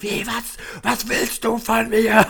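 mocking a shrieking, belligerent man; imitated yelling drunk. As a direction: ham up an aggressive stranger's screech. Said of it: "Der hat mich dermaßen angeschrien".

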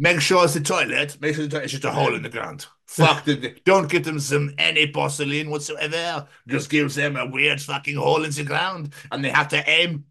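put-on indignant comic French accent. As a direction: give petty orders in a put-on French accent. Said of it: "Porcelain and French accent really tickle me".

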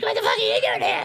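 high screechy robot yelp; Starscream shriek. Retold or imitated. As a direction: screech it like a whiny villain. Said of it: "Starscream's like".

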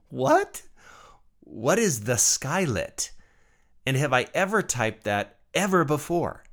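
high-pitched "what?" easing into puzzled questions; mock bafflement. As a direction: replay your bewildered reaction to a bizarre typo. Retelling baffled reaction to autocorrect.

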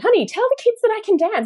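high, fast sing-song mama pig; mock cartoon pig wife, high and quick. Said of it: "she says to her husband".

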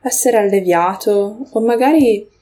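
unhurried meditation guiding, long pauses between phrases; guided meditation. Acted. Podcast host leading listeners through a grounding moment, guided meditation. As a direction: guide listeners gently into the breath, leaving space.

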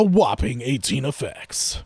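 slow, hammed-up ad voice; mock announcer. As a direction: draw out the grand announcement. Reading press release, hamming it up.

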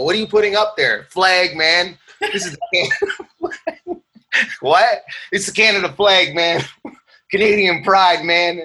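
high-pitched, amused flag-boasting bit; mock patriot neighbor. Comic acts out neighbors arguing over a flag, high mock-proud voice.

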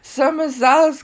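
mock drunk. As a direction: moan it like a tipsy woman on the phone. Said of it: "I'm really drunk".

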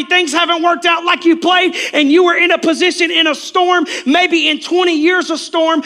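high, loud, driving preacher's shout; preaching at full force. Real, not acted. Preacher confronting the congregation about their anger, full-force preaching. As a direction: hammer the congregation with each accusation.

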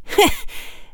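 high thin cold chuckle; a brief icy laugh; thin little chuckle. A narrator's reading. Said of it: "gave a thin little chuckle".

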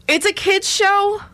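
high, slow and mocking; drawn-out ironic "kid's show"; sarcastic high voice. Shown as a demonstration. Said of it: "but it's not a kid's show".

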